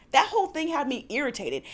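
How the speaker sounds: ordinary delivery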